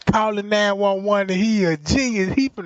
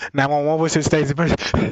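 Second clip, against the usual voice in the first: lower, fast, offhand dispatcher; bored dispatcher; 5 semitones lower, faster than usual. Retold or imitated. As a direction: rattle it off like a routine call taker. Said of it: "This how the nine one one call going".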